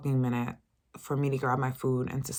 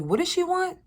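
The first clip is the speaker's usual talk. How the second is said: much higher snooty mock voice; high dismissive mimicry of the old lady; 4 dB louder than usual. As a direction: play the rude old lady brushing her off.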